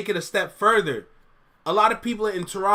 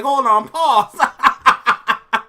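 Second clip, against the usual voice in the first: laughing; 6 semitones higher, 8 dB louder, much slower than usual. Retold or imitated. Repeating his amused reaction.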